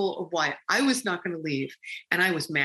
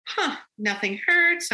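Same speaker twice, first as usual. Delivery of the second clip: mild surprise; 10 semitones higher than usual. Retold or imitated.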